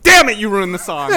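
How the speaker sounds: mock outrage. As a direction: burst out in comic exasperation. Acting out reaction to a lyric.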